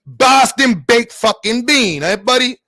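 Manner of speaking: put-on loud, slow, high Boston accent; mock Boston accent. New Yorker teasing Boston people, mock Boston accent. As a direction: drag it out in a hammed-up Boston accent. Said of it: "we don't like Boston accent".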